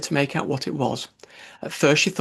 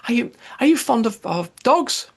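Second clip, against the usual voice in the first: eager; 10 semitones higher, 4 dB louder than usual. A narrator's reading. Alice eagerly changing subject.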